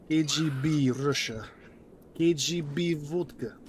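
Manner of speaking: a cartoon Russian demanding his vodka; thick put-on Russian accent; mock Russian accent. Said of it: "speaking in more different accents".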